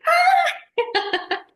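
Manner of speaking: high, loud squeal of recognition; retold delighted outburst. Spotting a fellow Colombian on the escalator, retold delighted squeal. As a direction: burst out high and loud, into laughter. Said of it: "Y yo".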